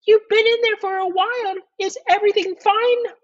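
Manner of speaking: very high, quick falsetto; high-pitched, inquiring wife voice; president's wife. Acted. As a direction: play the wife in a piping falsetto. Taft's wife speaks through door.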